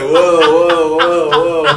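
fending them off, laughing; playful. Retold or imitated. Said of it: "I was like".

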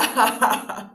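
loud gloating witch laugh; evil cackle. A narrator's reading. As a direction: cackle over the victim. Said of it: "se echó a reír".

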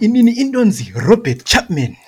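loud, high, mock rally speech in thick Zimbabwean accent; hammed-up rallying shout in a local Zimbabwean accent; switched-up local accent. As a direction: belt it out in an exaggerated ordinary-Zimbabwean accent. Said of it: "the accent switch up was amazing".

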